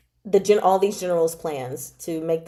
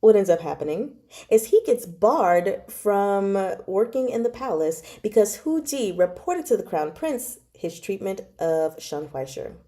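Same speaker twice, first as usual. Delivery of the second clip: ordinary delivery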